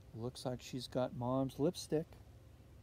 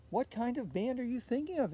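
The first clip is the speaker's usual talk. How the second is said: mother asking; 8 semitones higher than usual. A narrator's reading. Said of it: "asked her mother".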